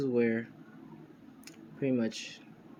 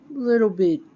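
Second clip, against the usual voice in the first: high, louder musing; acted pondering; 8 semitones higher, 8 dB louder, slower than usual. Deku weighs her confession, high-pitched mock pondering. Deku's acted hesitant reply.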